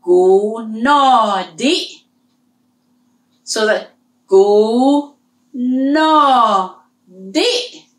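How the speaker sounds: "Gu no di" very slow, each syllable apart; teacher spelling out a sentence; slow syllable drill. Shown as a demonstration. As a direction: stretch the phrase syllable by syllable. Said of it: "Remember our first lesson".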